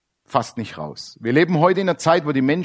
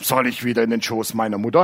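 a lower, dopey mock voice of a know-it-all; preacher mocking a scholar's silly question in a lowered dopey voice; mocking the religious man; 4 semitones lower than usual. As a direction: play him dim and indignant. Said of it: "der Religiöse".

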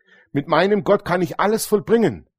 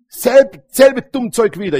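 sudden higher, louder self-scolding; exasperated outburst; 7 semitones higher, 7 dB louder, slower than usual. Real, not acted. Preacher scolding himself for saying it again, exasperated and loud. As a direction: burst out, fed up with yourself. Annoyed at his own old habit.

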